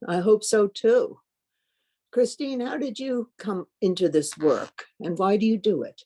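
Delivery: usual speaking voice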